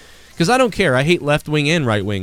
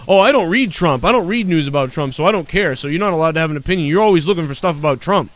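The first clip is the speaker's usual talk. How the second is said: mocking Troy; 5 semitones higher, 3 dB louder than usual. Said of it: "I'm just gonna say".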